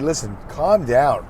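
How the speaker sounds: usual speaking voice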